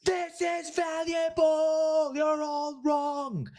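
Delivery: very high, slow, put-on yelling voice; mock yelling friend. Friend Tom yelling from his squeaky chair, very high and slow. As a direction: drag it out way up high like a cartoon heckler. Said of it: "your friend Tom in a squeaky chair just yelling out".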